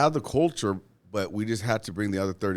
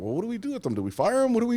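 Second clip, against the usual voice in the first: panicked HR mimic; 14 semitones higher, faster than usual. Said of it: "they were like".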